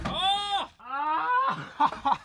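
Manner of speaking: sharp high yelp of pain, then a laugh. Real, not acted. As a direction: yelp as a finger gets pinched. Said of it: "My finger".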